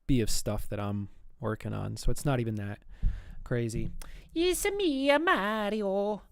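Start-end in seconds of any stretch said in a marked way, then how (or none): 4.4-6.2 high cartoon Italian accent; drawn-out falsetto Italian catchphrase; playful, high-pitched mock Italian accent; Mario. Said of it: "That's the accent that I kinda rock into".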